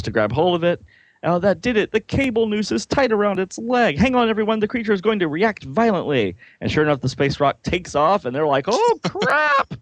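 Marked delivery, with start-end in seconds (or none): 1.2-6.3 high, excited comic-book alarm; urgent warning. Acted. Comic characters bracing for danger. 8.7-9.7 very high comic yelp; mock panic. Characters panic as rock takes off.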